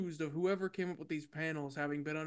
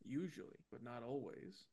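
ordinary talk, nothing marked

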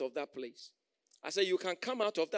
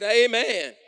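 much louder, higher amen; 4 semitones higher, 16 dB louder than usual. Real, not acted. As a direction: shout the amen out. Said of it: "I said".

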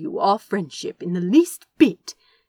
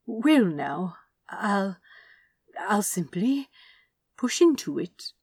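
false confidence. Acted. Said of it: "with a false confidence".

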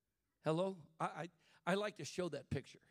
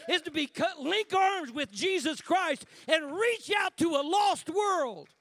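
slow, loud, high proclamation; preaching at full force; 8 semitones higher, 9 dB louder than usual. Acted. Pastor urging church to reach the lost, preaching loud. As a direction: lean into every word and shout it.

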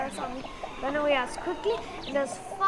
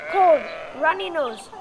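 ordinary delivery, nothing marked

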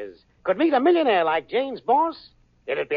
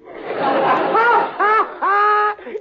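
high laugh; 12 semitones higher, 5 dB louder than usual. Acted. Al laughs at crime-wave joke.

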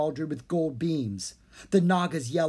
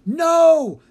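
huge, much higher and louder no; villain's furious roar; 13 dB louder than usual. A narrator's reading. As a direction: explode in rage. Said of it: "the wizard fumed".

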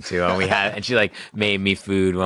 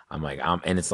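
ordinary talk, nothing marked

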